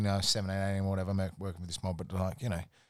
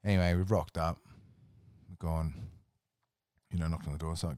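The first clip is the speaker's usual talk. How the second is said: ordinary delivery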